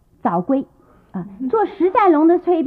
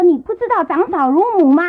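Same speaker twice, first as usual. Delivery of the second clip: louder, higher scolding from the stairs; 5 semitones higher, 4 dB louder than usual. Acted. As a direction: throw the line down at him in a rage. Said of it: "就骂起来了啊". (在楼梯上大声骂小叔子)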